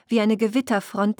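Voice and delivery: plain reading voice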